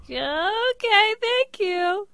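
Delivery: very high, sing-song polite voice; a high forced-nice okay thank you; high polite brush-off. Retold or imitated. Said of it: "So I was like".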